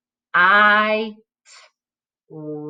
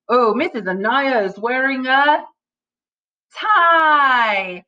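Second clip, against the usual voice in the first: rising, louder delighted call; excited exclaim; 4 semitones higher, 4 dB louder, slower than usual. Pretend. Teacher to class, excited about the tie. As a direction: point it out with a gasp of delight.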